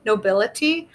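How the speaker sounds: ordinary delivery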